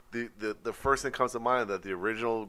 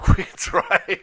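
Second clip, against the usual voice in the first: laughing; 8 semitones higher, 10 dB louder than usual. Real, not acted. Laughing at own joke.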